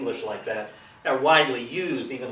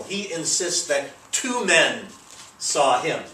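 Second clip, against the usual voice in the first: slow and deliberate, "two" punched; stress on two; 3 semitones higher, slower than usual. Shown as a demonstration. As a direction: hit the word "two" in "two men". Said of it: "The two is italicized just to emphasize it".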